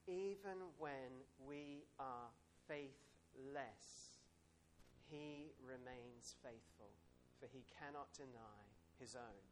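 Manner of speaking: slow, reverent reading of the verse; scripture reading. Acted. Preacher reads the verse to the congregation, slow and reverent. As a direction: read it out slowly, like holy scripture.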